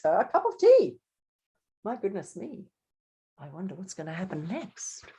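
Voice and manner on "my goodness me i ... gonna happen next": very soft, wondering aside; confiding storyteller's murmur; hushed suspense. Acted. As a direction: drop to a hush to build suspense.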